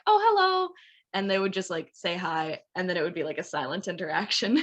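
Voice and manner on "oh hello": high, louder startled hello, imitating herself; reflexive polite greeting. Student greets a cashier abroad by reflex, high mock chirp. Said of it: "I reflexively was like".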